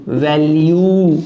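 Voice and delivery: वैल्यू drawn out slowly as "vel-yoo"; teacher modelling वैल्यू; slow demo pronunciation. Shown as a demonstration. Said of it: "ले, ये और यू".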